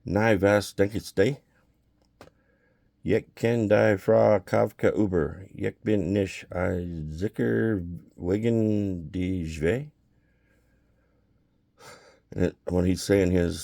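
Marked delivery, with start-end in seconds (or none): 0.0-9.9 slow, careful Yiddish, pausing between phrases; measured Yiddish dialogue; speaking Yiddish. Retold or imitated. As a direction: talk it through in Yiddish, unhurried. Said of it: "We spoke Yiddish".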